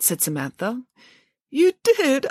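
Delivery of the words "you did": high, worried squeak; braced to weep, pitch jumping up; anxious Boris. A narrator's reading. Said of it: "bracing himself, ready to weep".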